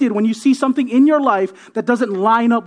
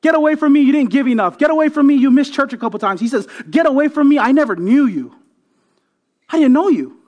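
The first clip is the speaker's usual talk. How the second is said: raised, higher preacher's rebuke; emphatic acted rejection; 6 semitones higher, 5 dB louder than usual. Jesus turning people away at judgment, emphatic acted rejection. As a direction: push them away with raised pitch and volume.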